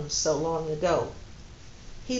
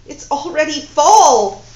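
slow, loud, high cry of dismay; dismayed outburst; 7 semitones higher, 13 dB louder than usual. Acted. Woman noticing autumn leaves, slow loud dismayed cry. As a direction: realise with horror that time is short.

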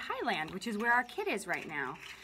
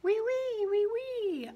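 high, loud whiny wee sounds; high mosquito buzz; mosquito whine; 9 semitones higher, 4 dB louder than usual. A narrator's reading.

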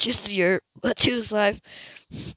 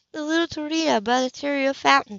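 higher woman's voice quoting him with scorn; mocking Hannah; 6 semitones higher than usual. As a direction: throw his words back coldly. Hannah mocks his call.

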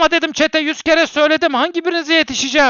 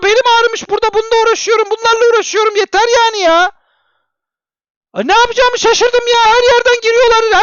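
exasperated rant; 6 semitones higher than usual. Real, not acted. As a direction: scold the chat, fed up. Streamer fed up with chat. (bıkkın, sitemkâr söylenme)

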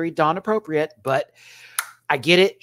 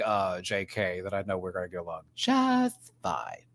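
ordinary talk, nothing marked

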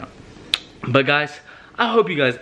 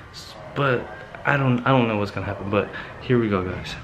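hushed; 4 semitones lower, 6 dB quieter than usual. Real, not acted. Sneaking up on sleeping Chris.

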